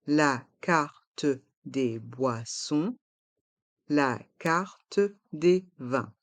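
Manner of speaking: "la carte des boissons, la carte des vins" syllable by syllable; slow model pronunciation. Shown as a demonstration. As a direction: slowly separate every syllable, sounding the e of "carte". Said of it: "Prononçons".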